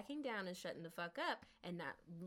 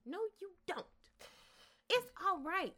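much higher mock lady voice; 11 semitones higher, 4 dB louder than usual. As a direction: toss it back in her prissy voice.

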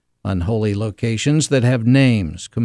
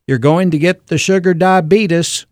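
a lightly voiced Southern grandmother, higher and warning; 5 semitones higher, 3 dB louder than usual. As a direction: wag a finger like granny. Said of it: "your grandmother would warn you".

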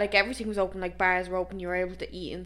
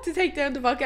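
usual speaking voice

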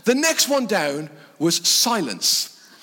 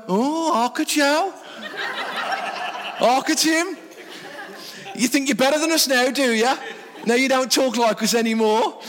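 higher-pitched, mocking Midlands accent; 6 semitones higher than usual. Midlands locals teasing the returning student, mock-offended Midlands accent. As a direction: imitate indignant folk back home in Midlands dialect. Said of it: "back home to the Midlands for holidays".

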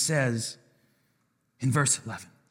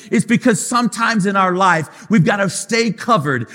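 preaching at full force; 3 semitones higher than usual. Acted. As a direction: drive it home like a revival climax. Preaching builds over the music.

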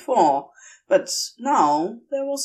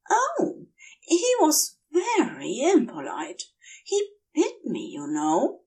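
higher, dreamy, lilting angel confession; a giddy angel drunk on venom, gushing; tipsy Aziraphale; 5 semitones higher than usual. Acted. Aziraphale prattling, drugged.

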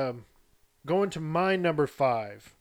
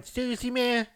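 much higher, lisping boxer voice; Mike Tyson impression; 9 semitones higher than usual. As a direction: toss it off in a high boxer's lisp. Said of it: "you got Mike Tyson going".

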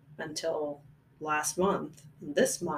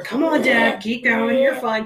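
loud urging; 5 semitones higher, 11 dB louder than usual. Pretend. Urging dad on during run.

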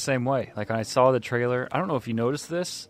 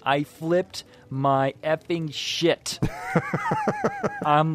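usual speaking voice

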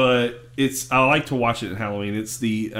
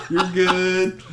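higher, put-on all-clear through laughter; SpongeBob; 5 semitones higher than usual. SpongeBob guiding the boat as it scrapes, high laughing impression. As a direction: imitate a clueless spotter waving someone on. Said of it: "SpongeBob's looking over the side".

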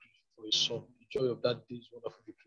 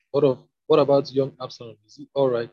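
louder, urgent royal question; demanding; 9 dB louder than usual. A narrator's reading. King asking the messenger about young Absalom, demanding. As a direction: press loudly for an answer. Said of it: "the king demanded".

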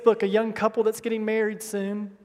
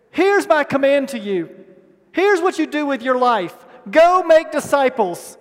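Jesus' command; 4 semitones higher, 7 dB louder than usual. Retold or imitated.

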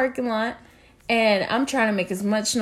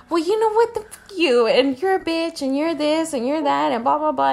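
mock angry boyfriend; 6 semitones higher than usual. Boyfriend insulting her, voiced.

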